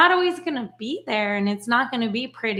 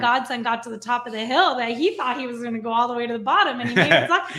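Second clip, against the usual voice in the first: ordinary delivery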